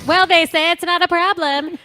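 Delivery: put-on high, bright-eyed valley girl quote; breezy, carefree sorority girl.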